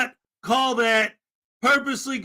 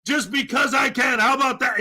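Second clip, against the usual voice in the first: mocking hostile auditor; 3 semitones higher, faster than usual. Imitating an auditor who answers a friendly question with a fast angry rant. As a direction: ham up a touchy auditor snapping back at a friendly question. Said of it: "They say".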